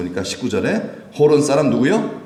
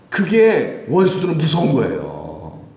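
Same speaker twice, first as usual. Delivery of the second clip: ordinary talk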